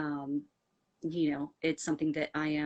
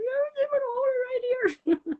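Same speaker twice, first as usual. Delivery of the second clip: high wailing mock sob, much higher and louder; mock bawling; 8 dB louder than usual. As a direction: imitate herself bursting into tears. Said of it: "I start bawling".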